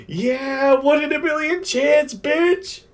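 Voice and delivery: high-pitched mock yell of triumph; hyped-up taunt. Anime fan voicing his hero's lucky strike, hyped-up taunt. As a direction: squeal it like a hyped hero.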